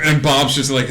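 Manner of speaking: ordinary talk, nothing marked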